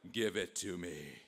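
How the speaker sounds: low, slow demand; demanding Napoleon. Acted. Napoleon demanding money from the envoy, low and slow. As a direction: stretch the order out, low and menacing.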